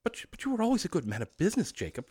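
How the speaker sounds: high, stammering and hurried; faltering. Acted. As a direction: falter nervously, fast and high. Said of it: "faltered Scrooge".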